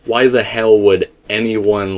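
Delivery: ordinary talk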